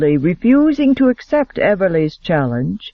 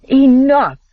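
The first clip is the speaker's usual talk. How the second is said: loud, high barked command; sharp snap; 8 semitones higher, 5 dB louder than usual. A narrator's reading. Duke stopping the quarrel at the table, loud snapped command. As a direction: cut them off hard. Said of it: "snapped the Duke of Hammond".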